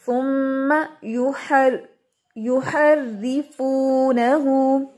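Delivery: युहर्रिफूनहू slow, doubled र्र kept thin; tajweed recitation. Shown as a demonstration. Teacher recites an example for students, slow tajweed. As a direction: recite the verse slowly. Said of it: "मैं मिसाल दे रही थी".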